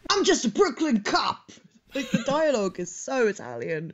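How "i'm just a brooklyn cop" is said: quoted dub line in a New York voice; Brooklyn accent. Retold or imitated. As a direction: tough cop, Brooklyn accent. Quoting dubbed film line.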